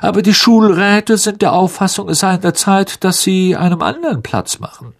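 high-pitched, silky Malfoy-type villain voice; smooth, condescending official. Acted. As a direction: deliver bad news in a high, mannered voice.

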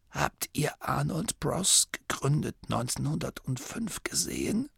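dying abbot's whisper. A narrator's reading. The dying abbot whispering a secret into Masklin's ear. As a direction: breathe it out like a frail dying old man.

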